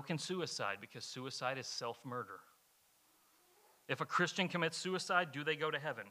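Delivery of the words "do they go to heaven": ordinary delivery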